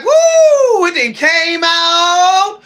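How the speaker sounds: high, loud whoop of praise; mock church hallelujah, loud and slow; joyful shout. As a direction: shout it out like you're set free. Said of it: "You're like".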